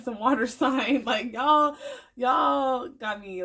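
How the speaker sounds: laughing loudly through emotion, the last words slow; half-laughing, half-overwhelmed; laughing emotionally. Real, not acted. To her viewers, laughing loudly at how moved she is. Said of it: "'cause I'm not this emotional".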